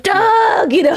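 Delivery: high, drawn-out mock shout; stretched, high-pitched holler; imitated fan call. Imitates fans calling out to comedian.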